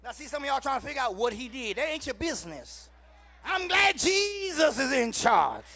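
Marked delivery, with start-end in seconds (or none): 3.4-5.7 full-force preaching. Acted. Preacher calling the church to rejoice, loud sung-out preaching. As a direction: lift the church with every phrase.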